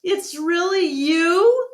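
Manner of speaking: high, slow, amazed question; can't believe it's really him. A narrator's reading. Ivan, astonished to see Bob again, asks slowly and high.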